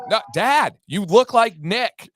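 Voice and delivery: high, loud mock kid's holler; imitating child's insistent yell. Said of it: "she yelled at me twice".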